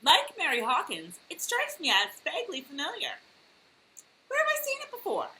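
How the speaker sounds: high-pitched mock musing; feigned vague recognition. Co-host teasing Carol about a familiar glass, mock puzzled. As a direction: muse aloud in a put-on high voice.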